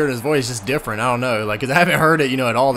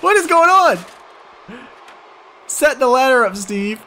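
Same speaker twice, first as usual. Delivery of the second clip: excited yell; 10 semitones higher than usual. Real, not acted. Game chaos, shouting at screen.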